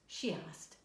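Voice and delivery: usual speaking voice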